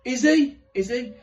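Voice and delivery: high, loud startled yelp; a sudden jump up in pitch at the news; shocked squeak. Retold or imitated. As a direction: caught off guard, voice leaping up. Shocked reaction to Irish.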